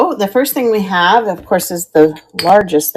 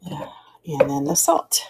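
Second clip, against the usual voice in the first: whisper; 8 dB quieter, slower than usual. Real, not acted. Aside while handling shakers.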